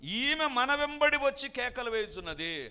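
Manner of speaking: loud, slow, exasperated complaint; complaining disciples. Acted. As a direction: whine to the master about the nuisance. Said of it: "శిష్యులు అంటున్నారు".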